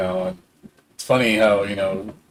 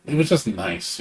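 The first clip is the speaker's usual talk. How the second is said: usual speaking voice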